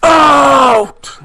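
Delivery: huge, high drawn-out shout; masked attacker's furious scream; commanding roar. Acted. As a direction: bellow it, stretching the last word. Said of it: "And he says".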